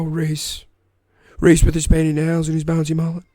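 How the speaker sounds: hammed-up presidential praise; American politician impression in short, repeated phrases; Donald Trump. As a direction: gush like an American politician, in a level sing-out. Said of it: "why do I sound like Donald Trump?".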